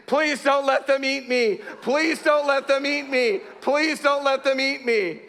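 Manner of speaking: high, slow, wailing plea; imitating a terrified boy's drawn-out wail; mock sobbing plea. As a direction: beg for your life, hammed up. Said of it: "He's sobbing uncontrollably".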